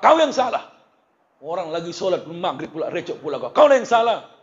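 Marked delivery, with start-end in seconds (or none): 0.0-0.7 much higher, louder scolding snap; mock mother yelling at her son. 3.5-4.3 high, loud scolding repeat; mock angry mother. Mother scolding her child.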